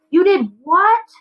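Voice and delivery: high, loud, indignant burst; outraged teen shocked at his father; spat out in outrage. A narrator's reading. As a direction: spit the words out at your father. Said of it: "Mustafa spits out the words".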